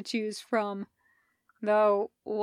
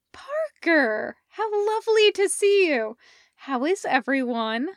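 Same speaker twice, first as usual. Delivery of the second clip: delighted greeting; 6 semitones higher, 4 dB louder than usual. Acted. Sophie greets Parker on video.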